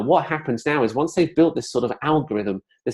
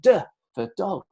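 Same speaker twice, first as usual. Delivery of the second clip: put-on toddler's excited duh; 8 semitones higher than usual. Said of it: "he pointed to it and he went".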